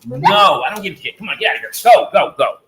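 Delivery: yelling high and loud to split up fighting dogs; real angry yelling. Real, not acted. Owner yelling at his fighting dogs, furious shout. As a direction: bellow at the dogs to stop now.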